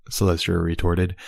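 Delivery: ordinary delivery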